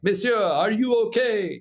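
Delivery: put-on high-pitched, gallant French-accented English; imitated French accent. French rescuer running over to stranded climber, imitated French accent. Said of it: "awesome French fucking helicopter rescue".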